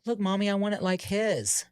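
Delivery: put-on high, eager child's voice; a small boy begging his mom; little kid voice. Little boy tells his mom what haircut he wants, in a high eager child's voice. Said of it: "And he's like".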